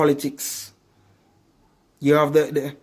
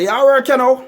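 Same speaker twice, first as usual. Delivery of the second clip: high loud questioning voice; acting out a street question; 10 semitones higher, 6 dB louder than usual. Preacher acting a man met on the road, high and loud. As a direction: ask it like a stranger on the road, voice up high. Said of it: "you said to them".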